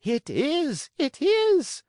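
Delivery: very high, loud shriek. Acted. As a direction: squeal it with joy. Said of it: "shrieked Signora Rosaura".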